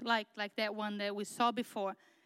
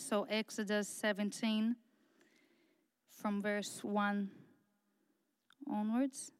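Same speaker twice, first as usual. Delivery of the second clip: ordinary talk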